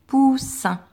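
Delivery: poussin lifted high and clear; dictation word. Shown as a demonstration. Teacher dictating poussin to pupils, bright and clear. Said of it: "un mot de deux syllabes".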